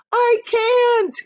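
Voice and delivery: high, loud broken admission; despairing cry. Acted. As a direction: James gives up as they fall. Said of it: "said James".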